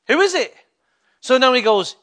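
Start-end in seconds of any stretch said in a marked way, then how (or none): 0.1-0.6 raised, higher call into the unknown; higher questioning call. Acted. Samuel answers a voice he doesn't know, higher questioning call. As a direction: pitch it up, calling out to an unseen voice.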